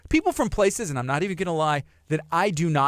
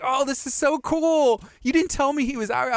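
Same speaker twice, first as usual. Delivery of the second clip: excited intern; 9 semitones higher than usual. Retold or imitated. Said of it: "He was like".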